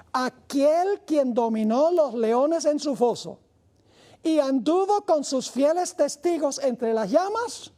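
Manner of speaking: solemn quotation. Acted. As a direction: read the quote as a proclamation.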